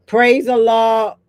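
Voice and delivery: slow, loud call of "Praise Allah". Real, not acted. Greeting the chat with a loud, slow praise. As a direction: call it out with devotion.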